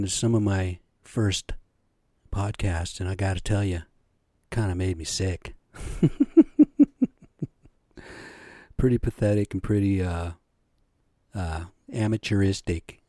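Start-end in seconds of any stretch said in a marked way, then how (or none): 5.8-7.1 a sudden high squeal of a laugh; high embarrassed laugh. Real, not acted. Laughing at his own early podcasts in a high squeal. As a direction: laugh at yourself, cringing.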